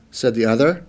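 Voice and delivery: plain reading voice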